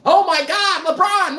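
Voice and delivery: high, breathless fanboy mockery; gushing fan. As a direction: swoon over LeBron like a blind fan. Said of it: "not from".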